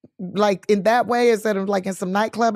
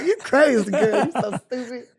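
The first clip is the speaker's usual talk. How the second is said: laughing friend; 3 semitones higher than usual. Retold or imitated. Friends laughing at her request.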